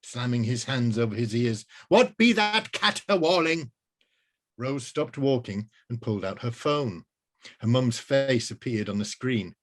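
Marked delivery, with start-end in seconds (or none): 1.9-3.7 high, loud archaic outcry; outraged wizard. Acted. Wizard hating the ringtone, high and loud. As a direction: protest the noise like an old mage. Wizard covering his ears.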